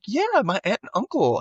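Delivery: high-pitched imitation of a girl; high girlish voice. Girl answering the caller, in mock high voice. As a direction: pitch it up like an excited kid.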